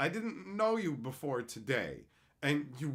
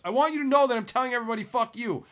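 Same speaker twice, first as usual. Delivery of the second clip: loud, high, rapid-fire mock yell; hammed-up confrontational holler; imitated yelling; 7 semitones higher, 9 dB louder, much faster than usual. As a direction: yell it through someone's front door. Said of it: "yelling it into their fucking house".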